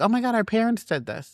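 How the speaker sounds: high-pitched mock horror; oh-so-innocent put-on dismay; feigned shock. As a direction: play a child pretending to be shocked. Kids faking shock over parents.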